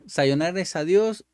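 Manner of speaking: ordinary talk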